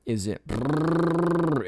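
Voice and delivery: slow high brrrr like a motor; engine noise. Retold or imitated. Hiker hearing a motor, mimicking it with a slow, high brrrr. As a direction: imitate an engine rumbling. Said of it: "I hear this sound".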